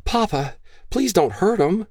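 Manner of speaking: put-on high, pleading young woman's voice; pleading daughter. Daughter begging her gun-waving father, high and pleading. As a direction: beg your father to spare him.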